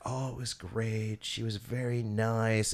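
slow, soft impression of a creepy guy; imitating a man who talks long; drawn-out weird talker. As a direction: stretch the words out, quietly. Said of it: "he talks long".

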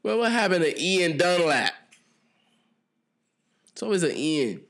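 high-pitched teasing holler; mock call-out. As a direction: call him out in a high, playful cry. Jokingly calling out Ian Dunlap.

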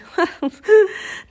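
high, loud burst of laughter; acted laugh. A narrator's reading. Teddy laughs after escaping the bone, acted laugh. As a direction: laugh as if from nervous relief. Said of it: "Then he started laughing".

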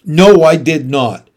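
sharp, loud protest; loud denial. Acted. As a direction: insist it loudly. Mom denies loudly.

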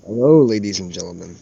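low, unhurried podcast intro voice; ASMR voice. Pretend. Host welcoming listeners to the pilot episode, low ASMR voice. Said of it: "that A S M R voice".

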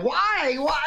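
high-pitched exasperated cry; hammed-up cry of frustration; mock wail. Acting out past frustrated complaint.

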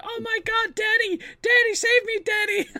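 very high, loud squealing cry for help; hammed-up damsel screaming for Daddy; panicked woman role. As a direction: shriek it in mock terror. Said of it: "she's like".

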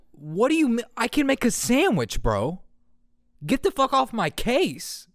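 high, outraged protest; defiant dream self. Retold or imitated. Dream self snapping back at the teacher, high and indignant. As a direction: sound wronged and indignant.

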